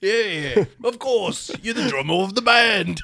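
hammed-up British recognition. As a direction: chirp back as a mock Liverpool bandmate. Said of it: "it's called [name] and [name]".

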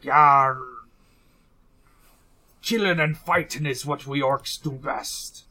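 a hint of a tough orc pirate; lightly growled pirate bravado. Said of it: "tough orc voice with a pirate accent".

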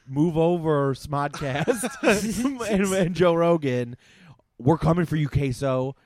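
shouting out rival podcasts; loud hammed-up trash talk; loud mock challenge.

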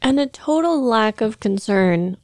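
usual speaking voice

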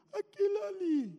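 high, loud tearful wail; hammed-up sobbing kid voice; mock crying child. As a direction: wail it out like a little tattletale. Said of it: "que que você tá chorando".

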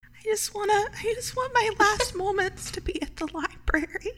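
high, soft, feeble plea; a hammy deathbed wish; mock last words. Mouse begs the lawyer for a library ride, mock dying.

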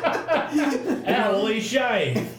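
slow, high, laughing Aussie voice; Australian accent. Pretend. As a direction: drag it out in a mock Australian accent. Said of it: "my Australian accent".

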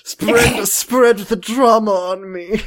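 slow, high British indulgence; hammed-up British relish; posh British put-on. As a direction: savour it in a silly high British voice. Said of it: "what is that voice?".

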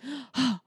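short mock gasp; imitated shocked gasp. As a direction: gasp as if scandalised. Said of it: "and then Siobhan's like".

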